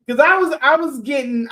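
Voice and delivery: ordinary talk, nothing marked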